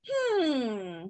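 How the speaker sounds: long falling "hmm"; drawn-out hum sliding down toward a no; doubtful hum. Shown as a demonstration. Student acts out a reluctant answer, long falling hmm. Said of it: "what if it's like".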